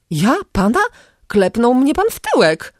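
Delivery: high, outraged cry at the stranger; indignant shout. A narrator's reading. Woman protesting to the vagrant, high outraged cry. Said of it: "krzyknęła".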